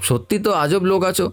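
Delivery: high-pitched, affronted retort; acted indignant complaint; exasperated protest. Boy protests at uncle's accusation.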